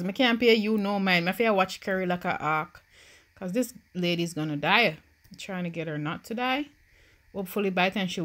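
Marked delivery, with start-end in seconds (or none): none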